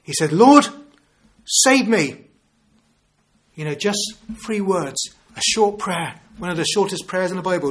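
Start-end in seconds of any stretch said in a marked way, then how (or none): 0.3-2.2 a high, loud desperate "Lord, save me"; retold cry for help. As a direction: call out like a sinking man. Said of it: "He cried out to the Lord".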